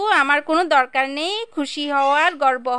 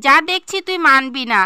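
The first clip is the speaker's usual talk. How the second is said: ordinary talk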